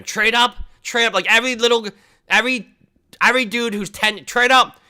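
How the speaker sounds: high, mocking chant of trade up; mocking kids. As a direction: parody the kids nagging.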